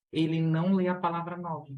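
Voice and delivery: usual speaking voice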